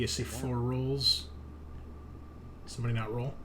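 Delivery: normal talking voice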